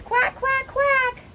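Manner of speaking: very high, playful quacking; happy duckling. Acted. Ducky playing with swans in the pond, happy high quacking. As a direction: pitch the quacks way up, happily. Duckling refrain in read-aloud.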